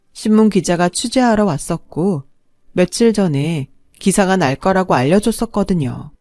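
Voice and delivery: ordinary delivery, nothing marked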